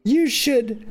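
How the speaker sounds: a hammy wounded protest; put-on character voice. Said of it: "He says".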